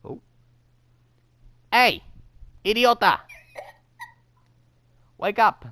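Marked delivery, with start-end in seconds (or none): 1.7-5.7 shouting. Real, not acted. Yelling at sleeping friend.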